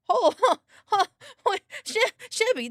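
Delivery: high, laughing hold on; giggling plea to wait. Real, not acted. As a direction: crack up mid-word. Laughing while cat judges.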